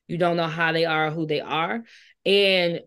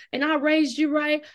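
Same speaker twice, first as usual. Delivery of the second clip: mocking a preachy mother; 7 semitones higher than usual. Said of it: "Talking all this stuff".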